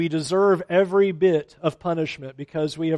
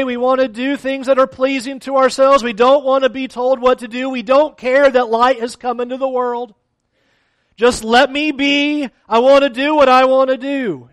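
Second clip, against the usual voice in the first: louder preaching at full force; 4 semitones higher, 5 dB louder than usual. Acted. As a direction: drive the point home to the congregation.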